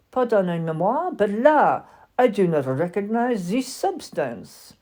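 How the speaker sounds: put-on haughty French doll, lightly accented; mildly French and superior. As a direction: sniff at it like a proud French lady. French walking doll speaks.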